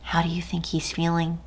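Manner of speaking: put-on quiet, quick question to the children; softly asking the little listeners; soft aside. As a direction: hush and ask the kids.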